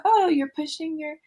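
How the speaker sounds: put-on high, sing-song motherese commenting on the child's play; high-pitched narrating of a toddler's play; parallel talk to a toddler. Shown as a demonstration. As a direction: coo over the child's toy car in a high voice.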